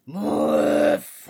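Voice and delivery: dog greeting the parrot; deep bark. Acted.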